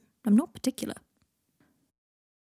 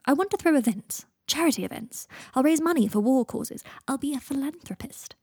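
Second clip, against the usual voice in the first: higher, eager character voice; pitched up, self-important enthusiasm; Draco unveiling his scheme; 6 semitones higher than usual. Acted. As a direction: announce the grand plan proudly.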